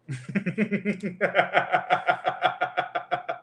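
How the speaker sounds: long run of rapid, pulsing giggles; long real laugh. Real, not acted. Amused after talking about mama's cussing, long laugh.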